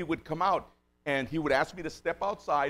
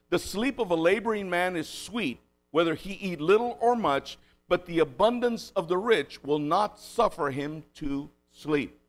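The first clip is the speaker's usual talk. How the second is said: slow, raised preaching read of scripture; 5 semitones higher, 5 dB louder, slower than usual. Acted. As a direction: read the verse solemnly and deliberately. Reads scripture verse aloud.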